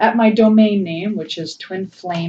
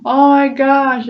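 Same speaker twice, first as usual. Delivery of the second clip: a loud, slow, overwhelmed "oh my gosh"; surprised gasp; 7 semitones higher, 9 dB louder, slower than usual. Real, not acted. Tarot reader reacting to the card for her viewers, overwhelmed surprise. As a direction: caught off guard by the card.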